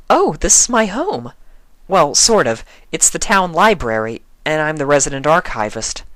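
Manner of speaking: rushing to explain her home; Twilight, flustered. A narrator's reading.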